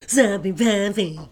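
low, drawn-out silly character voice; silly put-on voice. Trying a character voice on co-hosts, silly voice. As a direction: stretch it out in a goofy low voice. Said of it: "You like that voice or not?".

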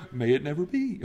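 softly restrained, polite refusal; nice and proper. Acted. Preacher quoting a tame translation to the congregation, nice and proper. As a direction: say it mildly, as proper scripture wording. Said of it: "That sounds very nice and proper".

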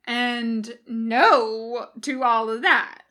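slow, firm, loud dismissal; succinct refusal. A narrator's reading. Bones rejecting Jim's plan, succinctly. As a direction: shut it down, word by word. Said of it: "Bones says succinctly".

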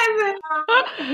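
very high sobbing laugh; high tearful laugh; crying laugh. Real, not acted. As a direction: break down laughing in tears. Emotional birth retelling.

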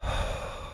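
slow audible breath out; long exhale. Shown as a demonstration. Pastor guiding a second breath, soft exhale. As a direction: let the breath go slowly. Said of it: "One more, one more".

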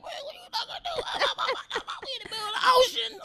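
very high, laughing mock shriek of the wife; husband imitating wife's panic on the boat, hammed-up; mock panicked wife. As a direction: imitate her panic in a squeaky voice. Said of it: "She like".

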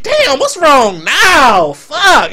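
high, loud, slow hammed-up groan of annoyance; dramatic exasperation. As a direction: ham up being fed up. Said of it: "I'm being dramatic for the show".

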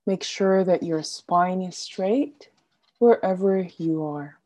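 ordinary talk, nothing marked

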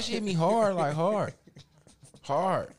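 HARD shouted high and loud; emphatic hard. Real, not acted. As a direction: hammer the word hard each time. Describing how hard she punched.

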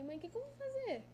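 high, helpless cry for help; a teen begging his mother for help; retold tearful plea.

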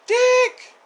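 high, loud imitated girlish scream; mock scream. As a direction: call out the name in a mock shriek. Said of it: "And then she screams".

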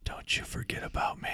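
breathy, voiceless whisper; whispered farewell line from a movie. Pretend. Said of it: "I whisper".

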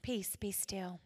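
soft, low, quiet command; calm and simple. Retold or imitated. Jesus calming the storm, soft quiet calm. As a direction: speak it gently, with calm authority. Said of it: "he very simply spoke".

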